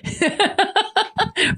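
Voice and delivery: long high giggle; high laugh. Real, not acted. Laughing at email.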